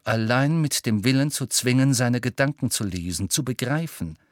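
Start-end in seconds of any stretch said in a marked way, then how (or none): none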